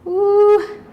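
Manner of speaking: excited whoop. Real, not acted. Instructor cheering on class.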